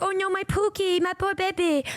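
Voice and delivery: high cooing over a hurt pet; doting baby talk. Retold or imitated. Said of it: "And I was like".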